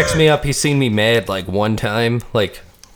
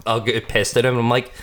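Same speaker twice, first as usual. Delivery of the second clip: ordinary talk, nothing marked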